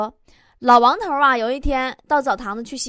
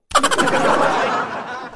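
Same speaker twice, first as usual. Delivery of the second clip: high airy cackle; high laugh; 9 semitones higher than usual. Real, not acted. Host cracking up at the internet-speed joke, high laugh. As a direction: let a laugh burst out.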